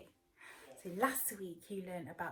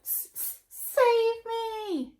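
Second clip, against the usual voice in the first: whispered stammer, then a high loud cry; stammer, then plead high; high stuttered plea; stuttering plea; 14 semitones higher, 12 dB louder, much slower than usual. Acted. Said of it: "stuttered Pear".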